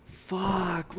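usual speaking voice

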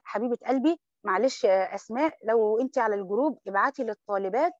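ordinary delivery

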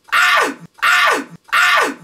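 very high short screams; three short, piercing squeals; excited screams. Real, not acted. Thrilled reaction to the scene.

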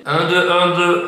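counting out loud to test the echo; loud echoing sound check; cathedral echo. Shown as a demonstration. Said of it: "Ça résonne trop". (écho de cathédrale)

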